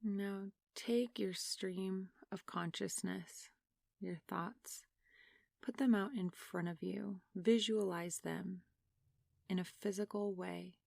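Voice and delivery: soft, unhurried meditation voice with long pauses; guided meditation. Real, not acted. Meditation leader asking listeners to close their eyes and breathe, soft guided meditation.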